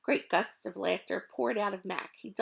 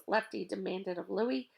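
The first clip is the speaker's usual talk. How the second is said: plain reading voice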